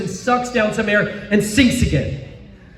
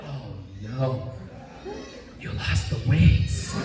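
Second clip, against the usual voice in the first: mock dismay; 8 semitones lower, 10 dB quieter than usual. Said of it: "and I'm like".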